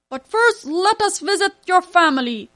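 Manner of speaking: loud, high regal king; king's voice. A narrator's reading. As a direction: command like a king. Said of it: "the king said".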